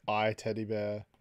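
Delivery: ordinary delivery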